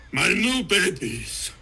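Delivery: gleeful villain. Acted. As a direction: savour the new toys, slowly and loudly. Villain gloating over his Waldos.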